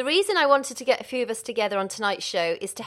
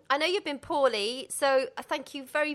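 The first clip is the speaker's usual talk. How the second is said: ordinary delivery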